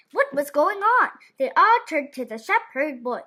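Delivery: ordinary delivery, nothing marked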